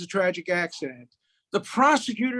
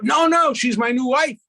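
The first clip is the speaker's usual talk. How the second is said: raised, louder voice, protesting; 4 semitones higher, 7 dB louder than usual. Acted. Old man correcting his friend about his new wife, loud protest. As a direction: cut in loudly to correct him.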